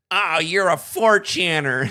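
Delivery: mocking accusation. Mimicking the kid's loud, high accusation at the caller. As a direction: call him out in a loud, high mock yelp. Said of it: "And he goes".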